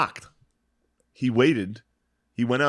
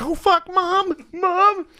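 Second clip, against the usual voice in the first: much higher, frantic mock panic; panicked sister; much slower than usual. Sister yelling for mom at the bottom of the stairs, high mock panic.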